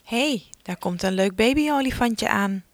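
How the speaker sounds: ordinary talk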